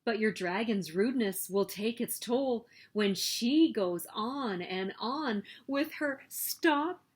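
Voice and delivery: plain reading voice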